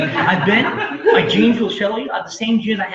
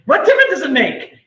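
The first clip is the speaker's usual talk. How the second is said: high, louder rhetorical challenge; exasperated; 7 semitones higher, 5 dB louder than usual. Acted. Speaker challenging people who correct a story, exasperated. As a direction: hammer the question home, pitched up.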